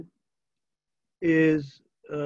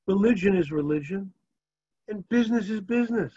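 higher, knowing thief's excuse; a wink of a town thief; sly thief; 4 semitones higher than usual. Retold or imitated. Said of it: "He looks at him and he says".

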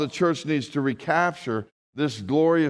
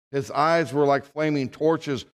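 ordinary talk

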